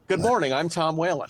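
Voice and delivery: ordinary talk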